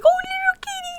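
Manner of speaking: very high cooing kitty voice; talking to a cat. Pretend. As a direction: coo at a little kitten. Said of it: "talking to a cat".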